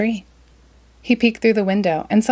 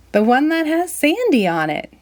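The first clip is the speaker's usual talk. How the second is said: Sandy voice; 8 semitones higher than usual. Acted.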